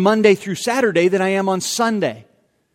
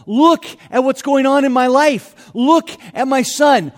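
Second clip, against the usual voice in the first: higher, louder cry of a desperate father; pleading father; 6 semitones higher, 6 dB louder than usual. Retold or imitated. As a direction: beg Jesus to look at the son. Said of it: "he's saying".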